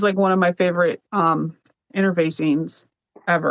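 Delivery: ordinary talk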